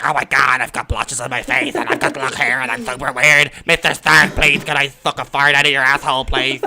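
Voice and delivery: a hammed-up creepy nerd begging; nerdy Guy Pearce. Mocking the nerdy villain to his friends, in a hammed-up nerd voice. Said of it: "and he's like".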